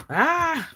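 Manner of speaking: short high yelp of joy; excited yelp. Real, not acted. Host yelps at the planner, thrilled. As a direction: let out a happy shriek. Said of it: "I apologize for yelling".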